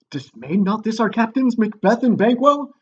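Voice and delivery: loud, high, dramatic royal question; King Duncan. Acted. King asks if his captains were dismayed, loud and high. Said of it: "And Duncan's like".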